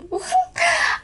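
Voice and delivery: high squealing burst of laughter; shrieking laugh. Real, not acted. Laughing hard at the artist's joke, high shriek. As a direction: crack up in a sudden high shriek.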